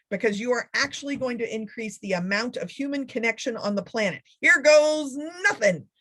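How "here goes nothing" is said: mock bravado. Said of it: "bracing to start".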